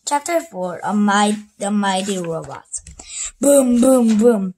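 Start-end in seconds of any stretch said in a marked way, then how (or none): 3.4-4.5 robot booming. Acted. Robot stomping sound effect.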